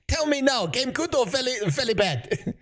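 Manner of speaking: high, thin put-on Chinese accent in broken English; mock Chinese accent.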